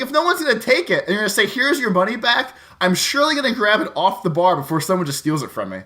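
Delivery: ordinary talk, nothing marked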